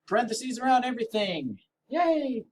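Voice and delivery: high, chirpy mock excitement; mock cheer. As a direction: squeal it like a cheerleader. Mock cheer over parentheses.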